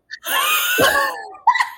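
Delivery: high burst of laughter. Real, not acted. Flubbed intro, bursts out laughing.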